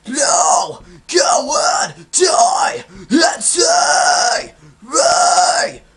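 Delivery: loud, high, drawn-out metal screaming; screamed phrases. Shown as a demonstration. Coach moving from sounds into phrases, screamed full force. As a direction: scream each word separately, raspy and stretched. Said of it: "when you start talking and saying phrases".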